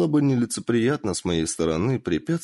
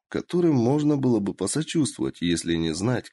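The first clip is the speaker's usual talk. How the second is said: ordinary delivery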